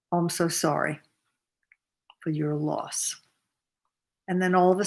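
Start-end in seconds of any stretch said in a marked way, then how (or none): 0.1-3.1 hushed, near-whispered sympathy; soft sympathetic condolence. Retold or imitated. Offering condolence to a grieving woman, soft and hushed. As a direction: drop to a soft half-whisper of sorrow.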